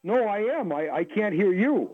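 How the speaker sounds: ordinary delivery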